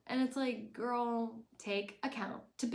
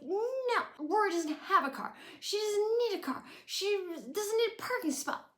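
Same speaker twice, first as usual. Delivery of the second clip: much higher, louder exasperated no; shrill Lorelai refusal; 12 semitones higher, 4 dB louder than usual. Retold or imitated. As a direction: refuse flatly, fed up. Lorelai refusing Emily's car.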